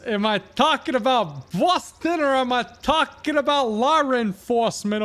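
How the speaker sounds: loud, high, slow hammed-up Boston accent; a rowdy Bostonian holding forth, hammed-up Boston accent. Bostonian ranting to a fellow local, loud Boston accent. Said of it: "I can't do the Boston accent".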